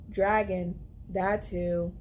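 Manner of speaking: usual speaking voice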